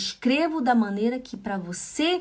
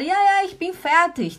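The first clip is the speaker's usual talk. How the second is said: higher, louder, bright ja ja ich bin fertig; cheerful reply; 7 semitones higher, 4 dB louder than usual. Pretend. Wife cheerfully telling husband the food is ready. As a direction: answer happily, done cooking. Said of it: "Eu falo".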